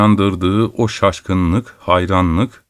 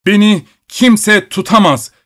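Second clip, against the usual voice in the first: much higher, louder furious shout; growing furious shout; 11 semitones higher, 4 dB louder than usual. A narrator's reading. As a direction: yell it, losing all control. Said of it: "gittikçe şiddetlenen bir sesle bağırıyordu". (gittikçe şiddetlenen yüksek bağırış)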